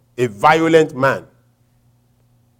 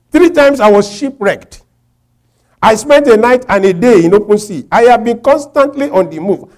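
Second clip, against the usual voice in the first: high, raised, driving pulpit voice; forceful preaching; 10 semitones higher, 8 dB louder than usual. Real, not acted. Preacher reciting Paul's hardships to the congregation, forceful. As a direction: press each point on the congregation.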